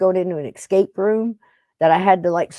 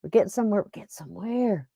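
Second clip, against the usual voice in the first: muttering to self; 8 dB quieter, faster than usual. Retold or imitated. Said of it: "I'm like".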